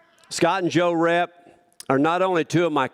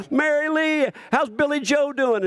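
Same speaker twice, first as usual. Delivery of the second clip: cheery greeting; 10 semitones higher than usual. Retold or imitated. Stranger greeting Mary Lee.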